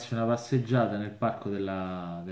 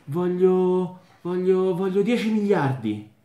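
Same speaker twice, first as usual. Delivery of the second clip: higher and louder, greedy stammer; eager wish; 9 semitones higher, 8 dB louder than usual. Acted. Man stammering his wish to the genie, excited and louder.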